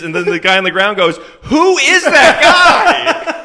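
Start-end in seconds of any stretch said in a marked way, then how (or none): 1.4-3.3 very high, loud, hugely drawn-out cry of confusion; bewildered yell. Retold or imitated. Man knocked to the floor by a slap asks the cops who hit him: high, loud, drawn-out bewildered wail. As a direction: wail it out, stretched and baffled.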